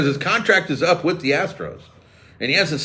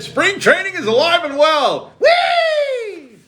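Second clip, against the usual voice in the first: gleeful whoop; much slower than usual. Real, not acted. Cheering spring training's return.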